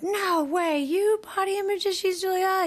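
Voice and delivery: high-pitched mock astonishment; incredulous disbelief. Voicing incredulous show audience.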